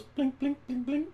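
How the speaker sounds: squeaky high blink noises, one per beat; high blinking sound. Acted. Bartender taken aback, blinking in high squeaks.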